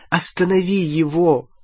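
desperate call to stop him; understated pleading cry; mild shout. A narrator's reading. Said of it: "закричала Гермиона".

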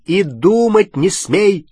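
slow, loud, high-pitched rebuke; barked order. A narrator's reading. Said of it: "рявкнул на неё Рон". (громкий рявкающий запрет)

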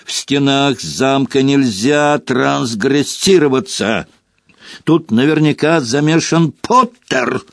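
agitated and forceful; straining, yelling. A narrator's reading. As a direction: shout the accusation, drawing out the words. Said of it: "надрывался Снегг".

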